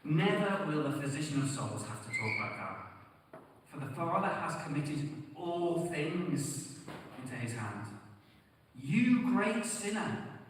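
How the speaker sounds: put-on reads the old sermon out with full force; fervent preaching. Preacher quoting Spurgeon to sinners, fervent and loud. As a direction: proclaim it like a Victorian pulpit preacher. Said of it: "Charles Spurgeon ... says this".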